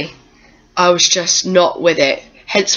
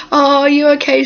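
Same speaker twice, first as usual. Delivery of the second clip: high, louder cooing question; fussing staff; 8 semitones higher, 4 dB louder than usual. Retold or imitated. Staff cooing over a patient, high and loud. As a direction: coo it like a worried nurse. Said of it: "they were all like".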